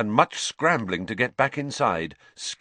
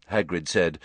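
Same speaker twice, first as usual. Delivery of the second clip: usual speaking voice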